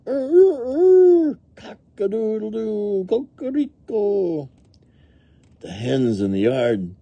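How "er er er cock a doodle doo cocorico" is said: high, loud crow trailing into slower calls; rooster crowing. A narrator's reading. The rooster in the farmyard, high crowing. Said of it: "The rooster".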